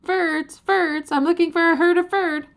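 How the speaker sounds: high calling shout; yelling. Acted. Ferd calling out for his kind, a high ringing yell. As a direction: call out across the hills. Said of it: "mouth and yelled".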